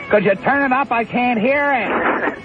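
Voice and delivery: host imitating his wife asking to turn the tape up. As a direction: call from the kitchen as his wife. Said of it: "She says".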